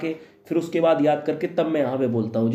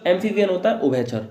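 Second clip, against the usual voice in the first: ordinary delivery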